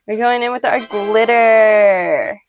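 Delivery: loud, drawn-out excited announcement. Real, not acted. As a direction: announce it with excitement, stretching "glitter" out big. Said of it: "I'm so excited for this part".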